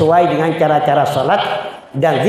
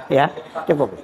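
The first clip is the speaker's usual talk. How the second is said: usual speaking voice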